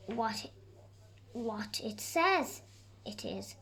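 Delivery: usual speaking voice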